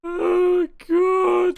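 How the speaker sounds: anguished cry. Real, not acted. Crying, can't stop.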